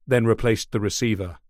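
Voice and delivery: ordinary delivery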